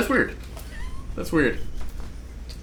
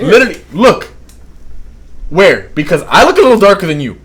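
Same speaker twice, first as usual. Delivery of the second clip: loud, higher-pitched protest; real loud argument; 5 semitones higher, 12 dB louder than usual. Real, not acted. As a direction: argue it out at full volume. Heated argument over skin shade.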